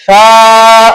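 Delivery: سا said with the tongue between the teeth, a lisped th-like sound, held. Shown as a demonstration. Teacher demonstrates the tongue-out sa for the pupil. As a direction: push the tongue out and hold سا. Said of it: "سا کو پڑھتے ہوئے زبان کو باہر نکالنا ہے".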